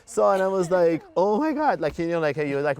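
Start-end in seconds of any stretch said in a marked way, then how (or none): 1.2-1.8 higher, louder shocked exclamation; retold alarm. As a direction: act out the moment of alarm. Retold shock at burning hair.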